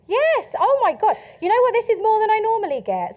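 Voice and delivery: high, loud thrilled reaction; delighted surprise. Real, not acted. Speaker thrilled by her audience's show of hands, delighted surprise. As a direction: exclaim it high, amazed at the response.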